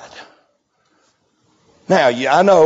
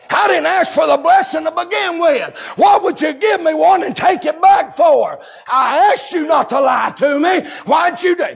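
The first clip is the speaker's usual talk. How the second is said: preaching at full force; 8 semitones higher than usual. Acted. Preacher voicing the bitter mother to the church. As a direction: cry it out as the grieving mother.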